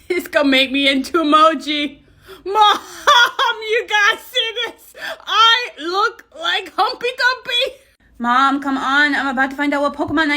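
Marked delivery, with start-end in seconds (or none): 2.5-7.8 high laughing squeal through the words. Real, not acted. Kid showing mom a silly filter, high laughing. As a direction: giggle it out, delighted.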